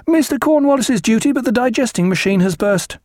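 put-on a scared boy blurting at the door; frightened midshipman. A narrator's reading. Midshipman at the cabin door, high and frightened. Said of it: "frightened midshipman".

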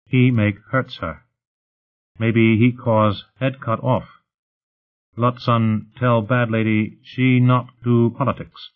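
slow, simple, halting speech; broken-English servant. Acted. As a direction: speak slowly in broken English. Whitey's broken-English report.

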